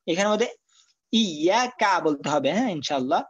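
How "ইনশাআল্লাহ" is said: usual speaking voice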